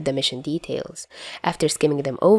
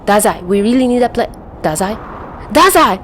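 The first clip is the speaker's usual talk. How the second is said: rising from worried to loud high cry; panicked calling; 5 semitones higher, 5 dB louder than usual. A narrator's reading. Chuya sees Dazai fall from the roof, rising panicked cry. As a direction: shout after him as he falls. Partner falls off building.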